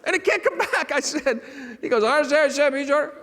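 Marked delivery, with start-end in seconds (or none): 2.0-3.1 mangle the order-taker's words past recognition; unintelligible garble. Retold or imitated. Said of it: "I think he said".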